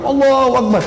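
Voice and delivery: high, ringing takbir cry; loud takbir. Retold or imitated. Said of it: "Saking kerasnya takbirnya terdengar".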